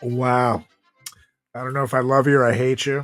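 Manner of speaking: ordinary talk, nothing marked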